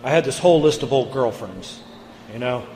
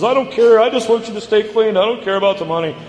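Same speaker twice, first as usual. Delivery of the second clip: mock mom, much higher and louder, pleading; 8 semitones higher, 5 dB louder, faster than usual. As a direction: voice his mother begging him to stay clean, high and loud. Mom pleads, tearful retold scene.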